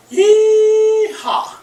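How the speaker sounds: high, loud yeehaw cry; cowboy yeehaw. Pretend. Host whooping yeehaw at the class.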